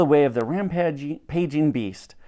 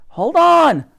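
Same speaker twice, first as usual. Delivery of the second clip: high, loud exchange between riders; urgent calling; 11 semitones higher, 6 dB louder than usual. A narrator's reading. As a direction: shout while clinging on for dear life. Riders call out while clinging on.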